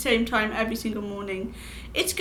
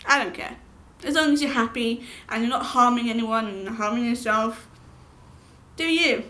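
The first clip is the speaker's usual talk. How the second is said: ordinary talk, nothing marked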